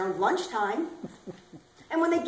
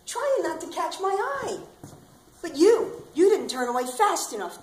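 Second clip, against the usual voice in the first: normal talking voice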